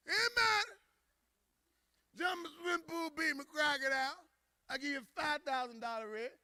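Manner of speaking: acted tipsy high-voiced president; drunk boss. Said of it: "He got drunk, started making deals".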